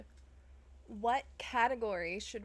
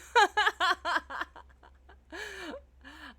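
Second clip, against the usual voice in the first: big burst of laughter, high and loud; loud startled laugh; 9 semitones higher, 11 dB louder than usual. Real, not acted. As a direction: cackle loudly after a fright. Loud laugh after a scare.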